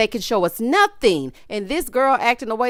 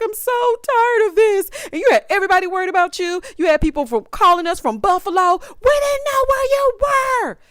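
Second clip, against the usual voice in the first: high, loud mock yell of Zariah; mocking Zariah's yelling; 10 semitones higher, 3 dB louder than usual. Reviewer acting out Zariah screaming at Troy, high mock yell. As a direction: shout it shrill and frantic, much higher. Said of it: "She's like".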